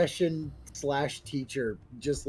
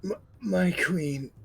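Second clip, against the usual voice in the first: dying gasp; 6 semitones higher than usual. Acted. Dying character's last words.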